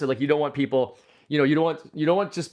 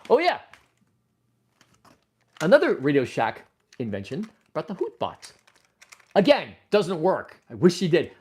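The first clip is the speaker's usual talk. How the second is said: usual speaking voice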